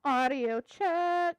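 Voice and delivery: slow, stretched-out sound check; drawn-out mic check. Real, not acted. Streamer testing whether viewers have audio back, drawn-out call. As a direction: draw out each word as a test call.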